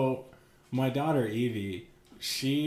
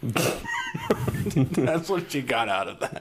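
slow, laughing through the words; 7 semitones higher, much slower than usual. Real, not acted. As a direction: laugh it out, slowly. Laughing at the daughter's idea.

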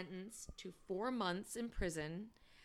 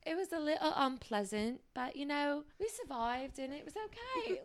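mock polite British voice, a touch higher; lightly British accent; 6 semitones higher than usual. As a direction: understate it like a polite Brit. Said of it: "it feels so polite".

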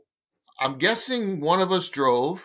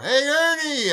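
friendly yell; 11 semitones higher than usual. Retold or imitated. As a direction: shout to a ballplayer to get his attention. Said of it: "And Phil yells over".

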